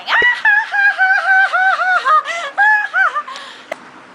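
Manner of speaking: very high, loud cackling laugh; long shrieking mock laughter; imitated laughing fit. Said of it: "starts laughing".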